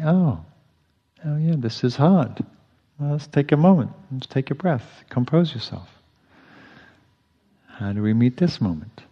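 calm yourself down tenderly; soothing self-talk. Shown as a demonstration. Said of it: "simple way of expressing".

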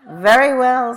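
high, loud giving-in; exasperated elephant consent. A narrator's reading. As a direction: give in loudly as the pestered elephant. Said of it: "said the elephant".

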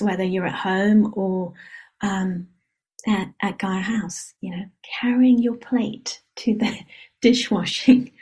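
usual speaking voice

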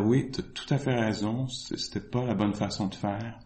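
low, soft, composed retold reply; very calm. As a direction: imitate a teacher calmly conceding and soothing. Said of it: "il était très, très calme". (voix basse et posée, calme imité)

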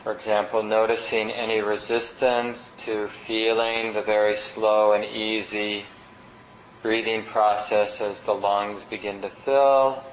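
unhurried meditation guidance with long pauses; guided meditation. Acted.

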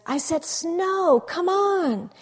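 high, loud, slow impatient demand; a child's furious order. Retold or imitated. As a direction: insist at the sky like a bratty kid. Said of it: "he says".

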